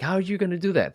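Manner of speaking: high-pitched incredulous question. Retold or imitated. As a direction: voice an amazed onlooker, pitch jumping up. Voicing amazed onlooker of confident people.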